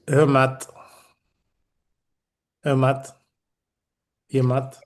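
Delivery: "Hermat" said as a Breton toast; Breton pronunciation. Shown as a demonstration. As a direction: show how Bretons say "Hermat", as a lone word. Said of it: "the way they say it".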